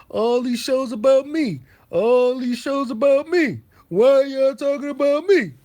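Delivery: high, loud mocking whine of a rival; mocking whiny rival. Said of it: "he's always talking about".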